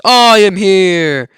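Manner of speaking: All Might's big slow loud hero call; heroic booming entrance. Acted. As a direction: boom it out like a triumphant hero arriving.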